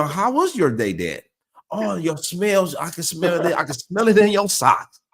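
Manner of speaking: high-pitched eager dog voice, breaking into laughs; excited dog. Acted. Dog greeting Dad after work, excited. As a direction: play the dog sniffing Dad, high and tickled.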